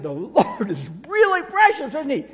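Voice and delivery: loud, high, laughing through the shouted question; exasperated laughing yell. Retold or imitated. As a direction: yell it a third time, cracking up. Third try at deaf lady, laughing.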